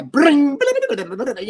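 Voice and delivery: vocal guitar noises, tumbling down from high; imitated flamenco guitar. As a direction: mouth a flamenco guitar flourish. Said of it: "like a flamingo guy".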